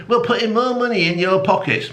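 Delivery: high, fast mock politician voice; a sneering high imitation of Starmer's promise. Mocking Starmer's promise to voters in a high sing-song. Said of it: "Starmer's going".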